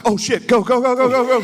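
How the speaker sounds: high, loud, rising go go go; excited cheering. Retold or imitated. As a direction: cheer on the runner from the couch, laughing. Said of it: "I'm like".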